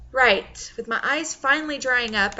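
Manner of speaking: plain reading voice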